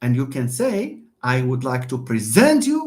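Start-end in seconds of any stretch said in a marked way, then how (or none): 1.2-2.8 preSENT, SENT punched as the verb; end-stressed "present"; stress on second syllable. Shown as a demonstration. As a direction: say "present" with a weak first and strong second syllable. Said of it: "stress the second syllable".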